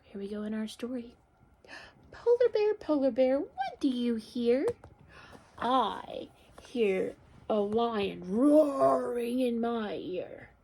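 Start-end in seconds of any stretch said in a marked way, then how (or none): 2.1-10.5 sing-out opening, slow drawn-out answer; picture-book read-aloud. A narrator's reading. Teacher reads polar bear story to children, slow expressive storytelling. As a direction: stretch the animal sound for little listeners.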